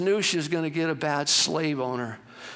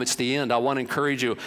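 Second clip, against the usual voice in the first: ordinary delivery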